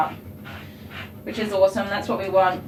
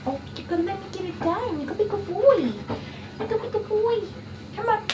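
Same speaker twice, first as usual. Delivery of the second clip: high, soft pleading coo; coaxing pet talk; 11 semitones higher, 6 dB quieter, faster than usual. Real, not acted. Groomer urging the dog to be good, high coaxing.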